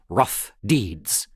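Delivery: ordinary delivery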